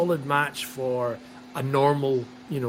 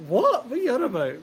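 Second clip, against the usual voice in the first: high-pitched incredulous outburst; mock bafflement; 10 semitones higher than usual. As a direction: question it in high, exaggerated disbelief. Acting out baffled reaction to commentary.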